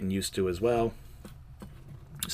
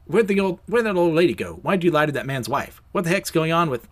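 acted outburst, raised and urgent; indignant questioning; 5 semitones higher, 4 dB louder than usual. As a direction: fire accusing questions at the orderly. Peter confronts the orderly.

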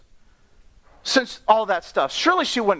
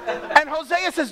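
ordinary talk

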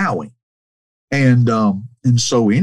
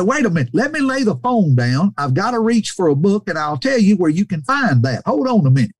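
put-on high, animated older preacher; busy, high-pitched instructions down the phone; Brother Cates; 7 semitones higher than usual. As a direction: imitate an eager old preacher, pitched high. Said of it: "he got on the phone and".

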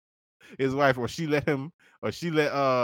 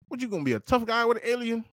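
ordinary delivery

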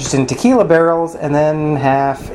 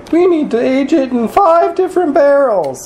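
mocking voice; 13 semitones higher than usual. Mocking trendy brewers.

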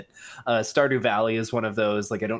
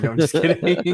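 laughing, louder and higher; 7 semitones higher, 7 dB louder than usual. Real, not acted. Laughs off joke.

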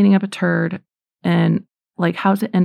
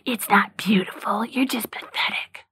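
Satan's whisper; 3 semitones higher, 5 dB quieter than usual. Acted. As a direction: whisper it like a sinister tempter. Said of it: "Satan whispers to me".

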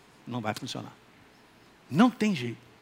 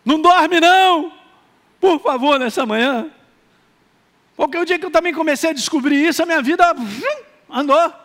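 higher, louder preaching burst; full-force preaching; 9 semitones higher, 7 dB louder than usual. Acted. Pastor urging the morning congregation not to sleep, preaching loud.

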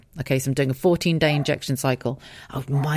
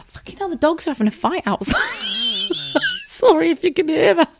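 laughing. Real, not acted. Dogs fighting outside.

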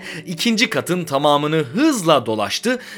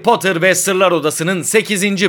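higher, louder, lively sign-off; podcast outro announcer; 5 semitones higher, 5 dB louder than usual. Real, not acted. Host thanking Potter fans for listening, lively announcer delivery.

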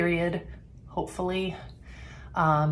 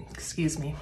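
whispering; 6 dB quieter than usual. Real, not acted. Apologising after gagging.

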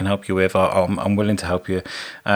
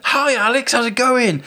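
friendly greeting; slower than usual. Retold or imitated. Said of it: "and he's like".

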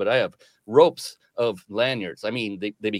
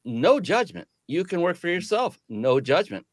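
higher, open, no pressure; a father to his kids, lightly voiced and higher; telling his kids; 9 semitones higher than usual. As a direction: lay out their options gently, pitched up. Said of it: "I told my kids".

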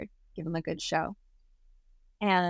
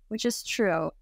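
usual speaking voice

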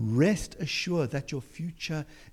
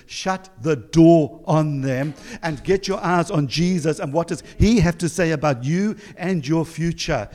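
higher-pitched preacher's exhortation, building louder; impassioned preaching; 4 semitones higher, 5 dB louder than usual. Real, not acted. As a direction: urge the congregation on with rising force. Preaching builds to full force.

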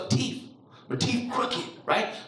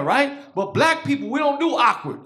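ordinary talk, nothing marked

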